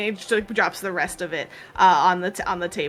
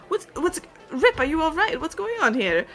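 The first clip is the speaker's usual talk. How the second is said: put-on worried old partner; 8 semitones higher than usual. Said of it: "he's just like".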